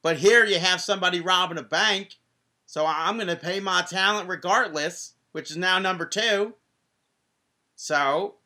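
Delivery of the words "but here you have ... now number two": higher, louder Southern-tinged newsreader voice; Dixie Carter impression. Host slips into Dixie Carter reading the news to listeners. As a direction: play a folksy Southern news anchor, y'all and all. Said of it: "Just go into Dixie".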